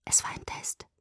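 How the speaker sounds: very soft, voiceless whisper; hushed, barely voiced. A narrator's reading. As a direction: whisper it as a dawning realisation. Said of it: "flüsterte ich".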